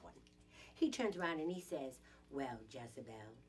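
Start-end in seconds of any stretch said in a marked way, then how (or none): 2.3-3.4 low, soft mocking imitation; condescending male colleague. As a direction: put on a colleague's low, patronizing voice. Mimics condescending male colleague's remark.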